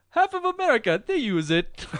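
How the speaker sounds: high, loud mock defendant voice; hammy high quote laughing; put-on voice.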